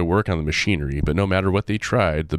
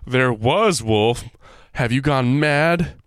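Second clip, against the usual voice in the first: slow high scornful disbelief; scoffing; 7 semitones higher, slower than usual. Acted. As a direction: scoff at a crazy idea. Hubert scoffing slowly.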